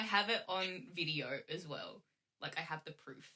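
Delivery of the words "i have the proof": normal talking voice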